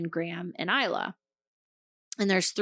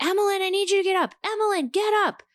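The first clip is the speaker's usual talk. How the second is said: high, fast yell calling a name upstairs; retold yelling; 11 semitones higher, much faster than usual. Mom yelling for her daughter during a gas leak, high fast yell. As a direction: call up to the loft, urgent and pitched high. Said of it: "So I'm yelling".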